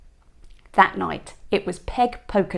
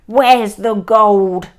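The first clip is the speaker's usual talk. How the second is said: loud, slow pirate bellow; shouted; 9 dB louder, much slower than usual. A narrator's reading. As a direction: roar the question slowly at the troll. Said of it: "shouted Hank Chief".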